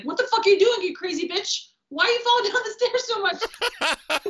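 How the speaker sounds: incredulous high yell breaking into a laugh; exasperated body. Retold or imitated. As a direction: voice her own body scolding her, half laughing. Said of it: "my emotional female body was like".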